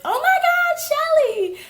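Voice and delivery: very high, loud squealed name; excited greeting back. Retold or imitated. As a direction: squeal back at a long-lost friend. Naturally reacting back to friend's squeal.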